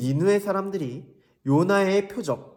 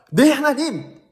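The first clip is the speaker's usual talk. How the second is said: higher, louder, eager answer; eager Jonah; 9 semitones higher, 7 dB louder, slower than usual. Acted. Jonah answering God eagerly, bright yes. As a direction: snap to obey like a keen child. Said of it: "그런데 요나 선지자가".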